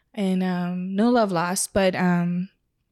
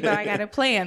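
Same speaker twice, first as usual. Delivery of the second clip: ordinary delivery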